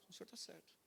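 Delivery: submissive reply. Retold or imitated. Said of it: "Eu abaixava a cabeça e falava".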